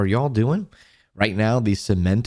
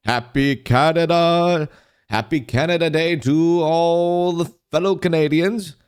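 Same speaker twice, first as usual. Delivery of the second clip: excited greeting; 6 semitones higher, 3 dB louder than usual. Real, not acted. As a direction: cheer it out to fellow Canadians. Streamer hails Canada Day to viewers.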